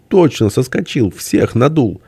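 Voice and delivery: high-pitched, giddy exclamations; hysterical glee. A narrator's reading. Friend of nations realizing all were fooled, hysterical. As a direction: pitch it up, near hysteria without laughing. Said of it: "вдруг истерически засмеялся".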